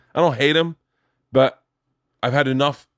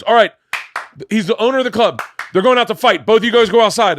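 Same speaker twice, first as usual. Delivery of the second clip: higher, louder retold orders; insistent club owner; 8 semitones higher, 6 dB louder than usual. Club owner ordering both comics outside, insistent.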